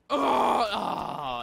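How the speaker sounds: very loud scream falling in pitch; roar, then let it sink; rage yell. Real, not acted.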